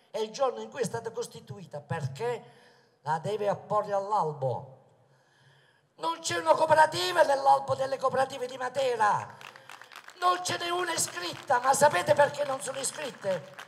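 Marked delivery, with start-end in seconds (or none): 5.9-13.5 loud rally oratory, projected to the crowd; booming balcony speech. Acted. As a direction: proclaim it from the balcony.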